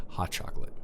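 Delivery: breathy whisper on the punchline; hushed, playful anticlimax; whispered reveal. Acted. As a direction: let the big reveal drop to a whisper.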